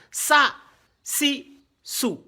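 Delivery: "Sa", "si", "sou" as brief separate sounds; spaced short syllables "Sa, si, sou"; short vowels. Shown as a demonstration. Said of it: "les voyelles brèves".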